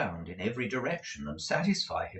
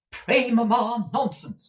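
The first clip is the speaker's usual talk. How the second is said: angrily; 13 semitones higher, 5 dB louder, slower than usual. A narrator's reading. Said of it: "said Missus Nickleby angrily".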